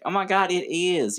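rough attempt at a friend's accent, high; Kayla's accent. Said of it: "that wasn't my best Kayla accent".

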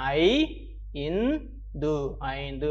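ஐ, இன், து as separate sounds, then ஐந்து joined; clearly sounded out. Shown as a demonstration. Teacher leading children to repeat, syllable by syllable. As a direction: spell ஐந்து out by ear: ai, in, dhu. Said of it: "தெளிவா உச்சரிக்கணும்".

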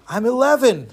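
lightly acted boy; small boy. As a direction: answer up like a little kid. Boy answers the giant.

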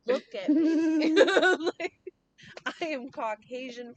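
high, loud, laughing exclamation; incredulous laughing mock protest. Mock protest to dad, amused.

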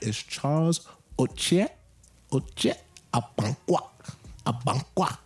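Oche as oh-CHEH, Abankwa as ah-bahn-KWAH; careful name pronunciation. Shown as a demonstration. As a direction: teach Oche and Abankwa slowly, each name set apart. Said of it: "Make sure you guys get it right".

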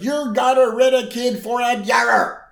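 high, loud breathless gibberish; mock garbled babbling. Homer frantically babbles to Marge, high and loud. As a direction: blurt it too excited to make sense.

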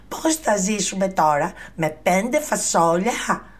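high, loud despairing scold; the mother rails at her son; angry mother. Acted. Mother scolding Jack about living on beans, high and loud. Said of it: "φώναξε η μητέρα του".

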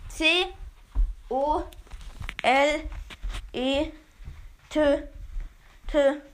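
letter by letter, with pauses; spelling it out. Shown as a demonstration. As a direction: spell each letter slowly and clearly. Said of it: "Ich buchstabiere es nochmal".

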